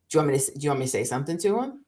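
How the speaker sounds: rapid, eager protective question; fast eager offer. Retold or imitated. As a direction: rush it out, eager to defend. Wife retells husband's eager offer.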